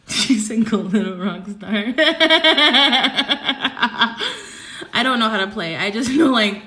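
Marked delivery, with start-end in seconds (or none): 1.8-4.9 long, high, loud cackle; loud cackling laugh. Real, not acted. Streamer called a little rock star, long loud cackle. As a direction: burst into helpless laughter.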